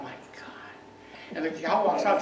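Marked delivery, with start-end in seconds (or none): 0.0-0.5 breathless whispered gasp of shock; hushed, aghast whisper. Retold or imitated. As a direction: whisper it under your breath, stunned. Shocked at a bouquet of thorns.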